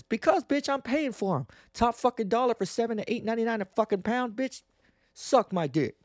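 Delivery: heated rant. Real, not acted. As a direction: lose your cool over the meat prices. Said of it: "Let me calm down".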